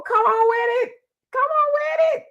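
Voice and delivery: ordinary delivery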